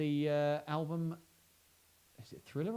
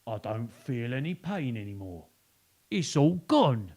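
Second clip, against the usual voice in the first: relieved crocodile; 5 dB louder than usual. Acted. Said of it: "the crocodile sat up and said".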